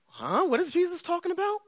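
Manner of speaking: high, fast, puzzled mock question; baffled listener. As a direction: play a listener who can't follow. Preacher voicing confused listeners.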